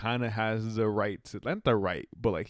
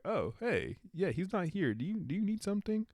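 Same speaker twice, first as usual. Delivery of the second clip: imitating an uneasy, higher-pitched greeting; awkwardly polite; 8 semitones higher, 4 dB quieter than usual. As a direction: act out a hesitant, polite brush-off in a higher voice. Voices girlfriend meeting the brother.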